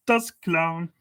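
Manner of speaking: high, put-on German accent for the clown; mock German. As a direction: announce it like a German showman. Naming the clown in painting.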